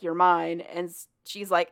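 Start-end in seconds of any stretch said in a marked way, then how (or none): none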